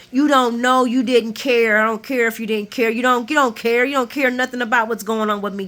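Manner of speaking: hammed-up angry shouting at Nina; angry yelling. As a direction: tear into her with rising fury.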